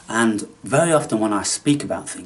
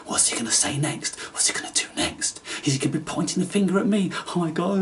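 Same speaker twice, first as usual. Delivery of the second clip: hushed then higher, quick fretting; mock nervous viewers; 6 semitones higher, faster than usual. Imitating nervous viewers fretting over what he'll say, hushed then rising. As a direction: fret like a jittery viewer.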